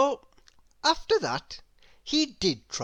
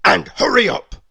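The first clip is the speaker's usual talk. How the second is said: louder gruff character bark; snapping demand; 3 semitones higher, 9 dB louder than usual. Acted. Mister Grumpy snapping at the cake seller. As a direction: bark the order at the shopkeeper. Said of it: "snapped Mister Grumpy".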